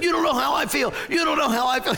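high, indignant protest; upset kid. Retold or imitated. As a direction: shout back like a hurt boy. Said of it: "I remember saying".

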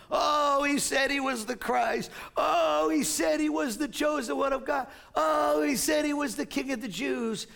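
high, loud jeering shout; mocking shout. Crowd mocking Jesus on the cross, loud jeering. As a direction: jeer at him like a scornful crowd. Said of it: "saying these names to mock him".

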